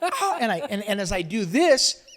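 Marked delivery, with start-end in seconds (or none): 0.0-0.4 reenacted scream. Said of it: "I go".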